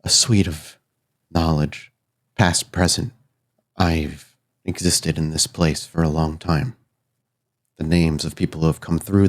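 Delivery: put-on measured and grave, like an old secret shared; solemn, knowing spirit. Spirit tells what it offers the newcomer, solemn and knowing. Figure offers his knowledge.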